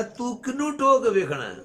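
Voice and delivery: high, loud acted question; loud, high rebuke in quotation; incredulous challenge.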